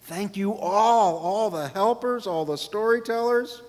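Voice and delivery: grateful thanks. Real, not acted. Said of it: "thanking helpers".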